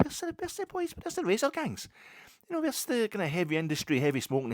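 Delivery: high, loud mock protest; mock dismay. Visitor missing old Govan's razor gangs, mock dismay. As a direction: demand it in high-pitched disbelief.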